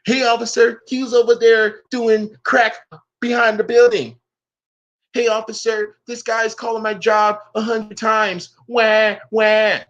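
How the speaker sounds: high-pitched mock complaint to police; mock code-switch voice. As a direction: imitate a tattler whining to an officer. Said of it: "sound like this".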